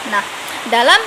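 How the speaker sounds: ordinary talk, nothing marked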